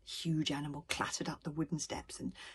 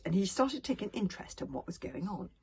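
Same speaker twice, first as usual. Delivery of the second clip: ordinary delivery, nothing marked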